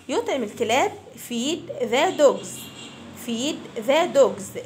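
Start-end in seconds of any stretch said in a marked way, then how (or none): 1.3-4.6 put-on very slow, clear drill phrase for children to repeat; slow model phrase. Shown as a demonstration. Teacher to fifth graders, model phrase very slowly. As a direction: stretch each word out for the class. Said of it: "Repeat after me".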